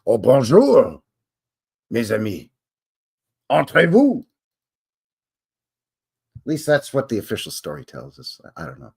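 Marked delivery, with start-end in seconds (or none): 0.1-4.3 hammed-up French accent greeting; mock French welcome. Louisiana greeting the Acadians, hammed-up French accent. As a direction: welcome them like a French host. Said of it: "and they said".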